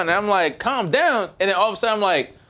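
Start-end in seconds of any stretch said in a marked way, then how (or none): 0.6-1.3 incredulous. Retold or imitated. Incredulous at being told calm.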